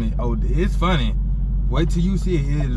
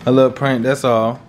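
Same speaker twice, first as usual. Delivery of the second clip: apologetic; 7 semitones lower than usual. Real, not acted. Boyfriend apologizing to girlfriend for the prank, sheepish and low. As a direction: coax her into forgiving you. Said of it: "I just wanna say I'm sorry".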